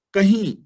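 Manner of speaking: ਕਹੀਂ with the last vowel through the nose; nasal kahin. Shown as a demonstration. Teacher showing the class the right reading, ਕਹੀਂ with nasal ee. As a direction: say ਕਹੀਂ with a nasal final ee, kahīn. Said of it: "ਇਹਨੂੰ ਪੜ੍ਹਨਾ ਹੈ ਕਹੀਂ".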